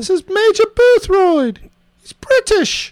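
high, put-on British voice; Major Boothroyd. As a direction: announce yourself in a high British accent. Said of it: "I'm doing it in a British accent".

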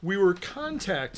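slow words, away from the mic; leaning back from the microphone; turned away from mic. Real, not acted. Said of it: "You're off mic".

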